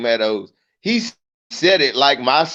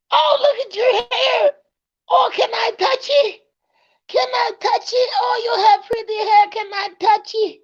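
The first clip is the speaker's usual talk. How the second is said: gushing lady. Retold or imitated. Comic mimicking a lady begging to touch a toddler's hair. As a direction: beg sweetly to touch the hair.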